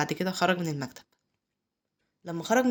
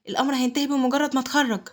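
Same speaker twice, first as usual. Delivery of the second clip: higher-pitched, heated retort; angry raised voice; 6 semitones higher, 4 dB louder than usual. A narrator's reading. Brother arguing over who should stay, angrily. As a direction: snap back with moderate anger. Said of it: "اتعصب مايكل ورد بصوت عالي".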